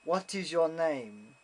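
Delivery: slow, deliberate judge imitation; slow questioning. Judge questioning a German visitor, slow and deliberate. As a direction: space out each word like an official.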